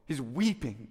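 ordinary talk

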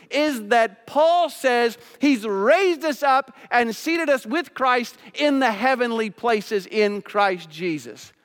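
loud, high preaching with punched key words; urgent pulpit delivery, voice lifted high; impassioned preaching. Acted. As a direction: plead with the congregation at full force. Preaches at full force.

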